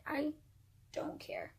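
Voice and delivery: hushed, falling almost to a whisper; quiet dismissal. Retold or imitated. Telling the boyfriend she's finished, hushed and dismissive. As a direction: retell a brush-off under your breath. Deadpan retold reply to boyfriend.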